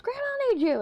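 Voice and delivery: high, loud call across the house; retold yell. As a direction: shout it like a teen calling from another room. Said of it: "she yells".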